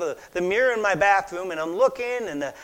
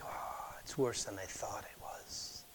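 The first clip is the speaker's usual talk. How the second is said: unvoiced, slow whisper of dismay; 9 semitones lower, 17 dB quieter, slower than usual. Husband dismayed to himself.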